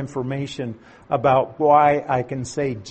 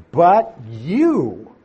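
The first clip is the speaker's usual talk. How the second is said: YOU said louder and weighty; stress on you; 5 dB louder than usual. Shown as a demonstration. Teacher rereading the verse to class, stressing you. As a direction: land hard on you for the contrast.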